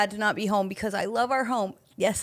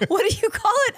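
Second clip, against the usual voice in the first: higher voice through laughter; laughing; 12 semitones higher, 3 dB louder than usual. Real, not acted. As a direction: ask it while still laughing. Laughs mid-sentence.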